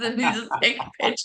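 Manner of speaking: ordinary talk, nothing marked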